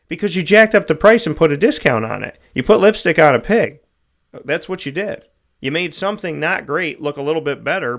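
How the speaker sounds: real full-volume rant; angry shout. Real, not acted. Host yelling at stores over fake discounts, loud and angry. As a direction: blast it out, fed up and furious. Ranting at price-gouging stores.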